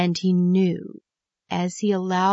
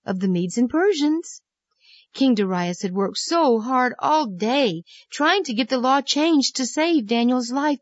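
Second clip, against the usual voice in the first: plain reading voice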